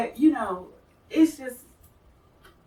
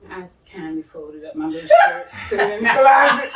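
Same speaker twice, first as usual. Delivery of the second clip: laughing while telling; 10 dB louder than usual. Real, not acted. Retelling putting the shirt away.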